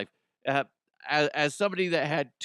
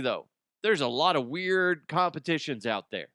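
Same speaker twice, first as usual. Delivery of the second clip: ordinary talk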